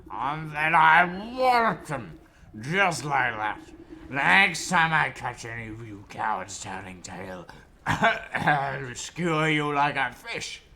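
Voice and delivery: put-on slow, booming drunken warlord; bear voice. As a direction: roar it out slowly and loud. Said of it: "I got the voice for the bear".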